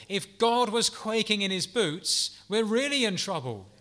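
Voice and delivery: animated emphasis. Real, not acted. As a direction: paint the absurd picture boldly. Animated exclamation about God.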